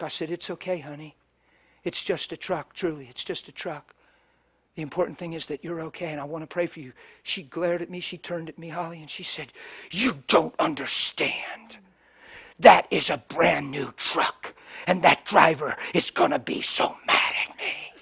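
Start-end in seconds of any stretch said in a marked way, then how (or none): 0.3-6.9 soft, tender reassurance; gentle comfort. Retold or imitated. Preacher soothing the woman who hit his truck, soft and tender. 9.9-17.9 soft, slow, breathy panic; imitating a panicked woman. Preacher imitating the frightened woman after the crash, soft and slow. Retelling her panicked words.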